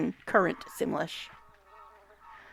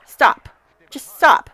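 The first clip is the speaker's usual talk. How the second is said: loud, high, punchy orders; raised voice, real frustration; exasperated command; 7 semitones higher, 9 dB louder, slower than usual. Real, not acted. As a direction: order a stubborn game character about, fed up. Ordering her stubborn Sim around.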